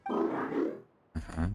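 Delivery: normal talking voice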